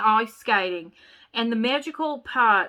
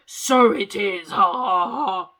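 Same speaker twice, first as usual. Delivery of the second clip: a hint of a deep chuckling snowman, slowed and loud; lightly voiced chuckling snowman; deep chuckly voice; 2 semitones lower, 5 dB louder, slower than usual. Shown as a demonstration. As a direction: chuckle it out like a jolly giant. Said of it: "said a voice in a deep, chuckly voice".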